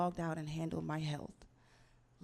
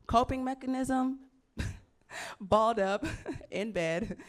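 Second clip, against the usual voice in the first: loud laugh breaking through; 4 semitones higher, 5 dB louder than usual. Real, not acted. Laughing about her coping mechanism, giggly delivery.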